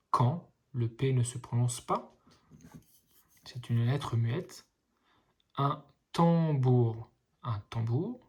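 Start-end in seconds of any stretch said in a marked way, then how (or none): 5.6-7.1 slow, split into syllables; slowly sounding out tam-bour. Shown as a demonstration. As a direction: sound out each syllable for a child. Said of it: "les petits ponts vous aident à lire".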